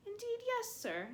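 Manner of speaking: high, squeaky elf voice, begging; a small elf scolding his hero; pleading house elf. Acted. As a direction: house elf, high and anxious, reproaching Harry.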